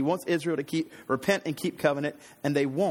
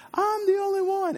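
mock whining. Preacher mimics Elijah wallowing in self-pity, whining. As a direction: whine it in an exaggerated high voice. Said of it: "it doesn't have to be him whining".

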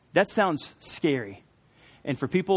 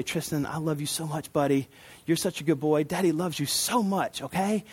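soft, tender fatherly murmur; 4 dB quieter than usual. Retold or imitated. Dad to his little son at bedside, soft and tender. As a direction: say it softly to a small child at bedtime. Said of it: "this is a tender moment".

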